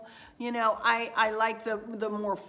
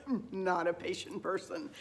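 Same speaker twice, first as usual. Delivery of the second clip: laughing; 3 dB quieter than usual. Real, not acted. Laughing admission after the joke.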